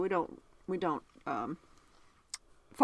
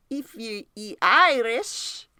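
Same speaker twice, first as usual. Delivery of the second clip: drawn-out, louder attempt at an Irish accent; bad Irish accent; 3 semitones higher, 7 dB louder, much slower than usual. Shown as a demonstration. Host trying an Irish greeting on her co-host, bad Irish accent. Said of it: "Was that bad? Let me try again".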